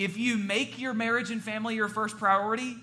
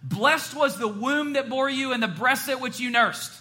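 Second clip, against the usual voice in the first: high-pitched mock outburst from a crowd; retold spontaneous shout; 7 semitones higher, 4 dB louder than usual. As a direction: imitate a moved listener crying out mid-sermon. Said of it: "she just spontaneously shouted out".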